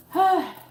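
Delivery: big loud sigh of relief; a loud, relieved sigh after finishing. Real, not acted. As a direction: let out a long high sigh. Done with a reading, lets out breath.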